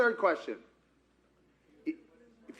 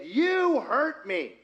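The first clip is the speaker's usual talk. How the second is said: higher, drawn-out accusing quote; acted hurt accusation; 5 semitones higher, 3 dB louder, much slower than usual. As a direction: play the wounded accuser, stretching the words.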